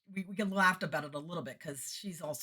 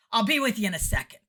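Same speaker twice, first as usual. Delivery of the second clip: loud, higher brisk call; brusque put-off; 6 semitones higher, 11 dB louder than usual. Retold or imitated. Busy sound guy to the next act, loud and brusque. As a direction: belt it out as the busy tech.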